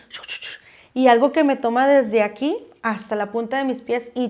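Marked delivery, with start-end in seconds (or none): 0.1-0.5 soft unvoiced chu chu of ghostly voices; imitated whispering voices. Retelling the voices she heard, whispered chu chu. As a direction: breathe the sounds like a faint whisper. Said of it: "como un susurro así".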